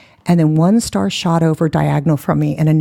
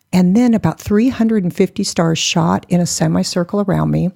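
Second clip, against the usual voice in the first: ordinary delivery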